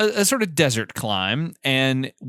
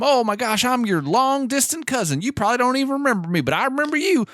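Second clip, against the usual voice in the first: put-on Southern accent; 12 semitones higher than usual. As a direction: gush in a high Southern accent like an overeager relative. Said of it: "a very Southern-sounding man".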